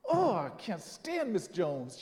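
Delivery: slow, drawn-out mock groan; grudging exasperation. Thinking to himself about Miss Jones, grudging exasperation. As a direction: groan it out reluctantly. Grudging admission, acted thought.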